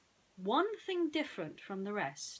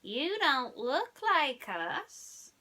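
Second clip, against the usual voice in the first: very slow, louder; suspicious alien; 5 semitones higher, 4 dB louder, much slower than usual. A narrator's reading. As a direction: drag it out in suspicion. Alien voice in the story.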